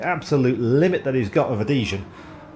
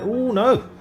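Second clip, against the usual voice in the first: surprised; 9 semitones higher than usual. Real, not acted. Near miss on new fastest lap.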